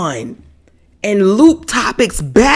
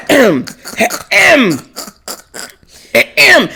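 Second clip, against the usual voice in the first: pointed throat clearing; 3 semitones higher than usual. Retold or imitated. Family member hinting at dad to finish the prayer, pointed ahems. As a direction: clear the throat pointedly to hurry him along. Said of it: "This is all you hear".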